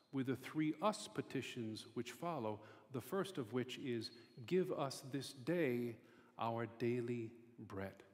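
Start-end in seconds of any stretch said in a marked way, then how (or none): 4.5-8.0 slow, deliberate emphasis on "this day" and "daily bread"; emphatic. Shown as a demonstration. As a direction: stretch out "Give us this day" and "our daily bread", measured and emphatic. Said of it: "notice how very emphatic that is".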